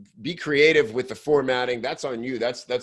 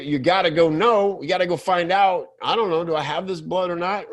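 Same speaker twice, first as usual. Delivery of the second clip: excited; 7 semitones higher than usual. Real, not acted. Coach plays a reader gripped by the hook, excitedly. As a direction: get hooked and itch to know more.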